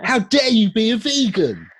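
loud, high-pitched indignant imitation; hammed-up hostile shout; mock outrage. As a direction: cry it out like a scandalised meat eater. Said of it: "how hostile people are towards vegans".

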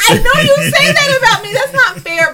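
high, loud squeal of objection; indignant protest. Real, not acted. Protesting being called fake.

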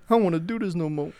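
quick, high whiny meme voice; a hammed-up defeated whine. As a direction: whine it fast like the TikTok meme. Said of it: "that meme on TikTok where it's like".

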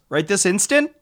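mock alarm at being rushed; surprised. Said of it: "And the guy goes".

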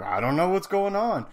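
high, loud mock-innocent protest; feigned ignorance. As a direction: play clueless, pitch climbing high. Acts technician feigning ignorance.

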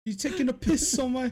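high Cockney voice; mock Cockney. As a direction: tease in Cockney. Joins in Cockney bit.

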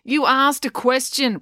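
high, slow, wounded objection; protesting. A narrator's reading. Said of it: "protested Finn".

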